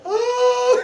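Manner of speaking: high, loud mock baby wail; imitated crying. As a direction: imitate wailing small children. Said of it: "and they're crying".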